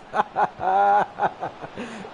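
short burst of jeering laughter; laughing fit. Real, not acted. Streamer laughing at those he mocks, jeering laugh. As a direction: laugh on after the jibe.

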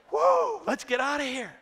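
very high, loud whoop; excited escape. Pretend. Preacher voicing animals fleeing the ark, loud and high. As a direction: burst out like freed animals.

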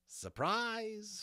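high, drawn-out surprise; mock surprise. Molly greets the magician with a grin, mock surprise. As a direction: announce yourself in a high, teasing voice.

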